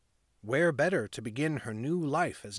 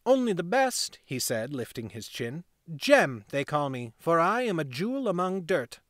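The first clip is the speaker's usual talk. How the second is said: put-on proud boy; 2 semitones higher than usual. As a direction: brag like the little gang leader. Boy naming himself proudly.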